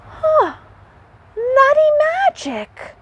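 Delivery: very high squealed wonder. Acted. Hedgehog to the animals, squealing with excitement. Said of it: "squealed Hedgehog".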